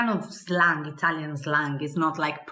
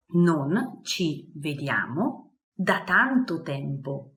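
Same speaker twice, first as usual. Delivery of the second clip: slow, clear repeat for learners; slow model phrase; slower than usual. Shown as a demonstration. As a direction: say it slowly so they catch each syllable.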